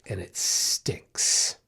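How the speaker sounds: drawn-out whisper. Real, not acted. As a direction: breathe "stinks" out as a slow, weary whisper. Lamenting getting bitten at work.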